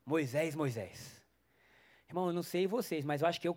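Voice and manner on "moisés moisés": low, slow, distant call of Moisés; voice from the burning bush. Acted. God calling Moses from the bush, low and slow. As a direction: call him slowly from far away. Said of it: "ouve uma voz dizendo assim".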